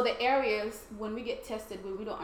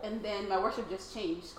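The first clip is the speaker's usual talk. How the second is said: usual speaking voice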